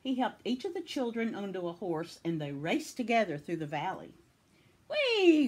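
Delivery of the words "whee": high, loud whoop of glee; gleeful cry. A narrator's reading. Bob races through the valley, crying out gleefully. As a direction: whoop as if racing on horseback. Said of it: "cried Bob".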